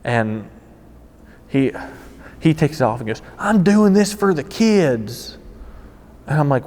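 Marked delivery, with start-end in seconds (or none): 3.4-5.4 high, long drawn-out mock plea; hammed-up high-pitched declaration; put-on prankster cry. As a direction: stretch it out slow and high, imitating the prankster. Clown mask prankster explains himself in story.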